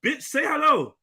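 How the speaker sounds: high-pitched, exasperated outburst; mock exasperated demand. Man demanding a greeting from someone ignoring him, mock exasperated.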